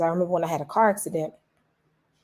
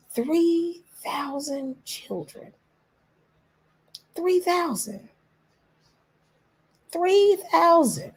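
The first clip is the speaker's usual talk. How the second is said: high, slow, grief-stricken repetition; heartbroken; 11 semitones higher, much slower than usual. Real, not acted. Vlogger grieving Gaza's children, voice rising in pain. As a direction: let the number break your voice. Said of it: "That broke my heart".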